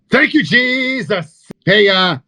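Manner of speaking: high, slow revival-preacher cry of praise; sarcastic hallelujah shout to the crowd; mock preacher. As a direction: ham up a tent-revival preacher. Mocking a snake-handling preacher.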